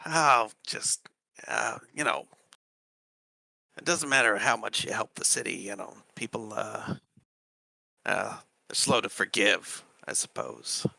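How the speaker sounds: soft, hesitant Dragonborn with pauses; downplaying Dragonborn. Dragonborn explaining the gnome to a visitor, quiet and hesitant. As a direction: trail off quietly, reluctant to explain.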